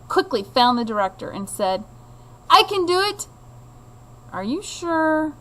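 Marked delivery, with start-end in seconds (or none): 2.5-3.3 higher, eager and sure. Acted. Boy eager to step in. 4.3-5.3 higher, questioning director voice; a lightly voiced director checking; doubtful director.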